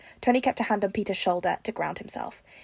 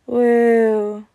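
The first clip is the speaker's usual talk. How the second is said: long drawn-out, louder woo; feeble cheer; 3 semitones higher, 7 dB louder than usual. A narrator's reading. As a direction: celebrate woozily. Peter's weak celebration.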